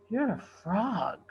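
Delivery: slow stunned whisper; shocked whisper. A narrator's reading. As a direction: breathe it out in disbelief. Realizing the finder is a frog.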